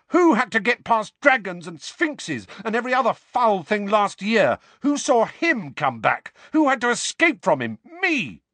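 high, loud, shouted questions hurled at friends; furious outburst. A narrator's reading. Harry yelling at Ron and Hermione, furious. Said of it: "his fury at being followed".

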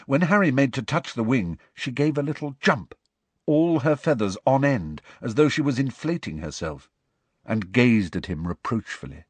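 usual speaking voice

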